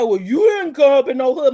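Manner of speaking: mocking doubter. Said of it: "when people say".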